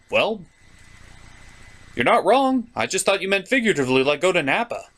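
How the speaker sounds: normal talking voice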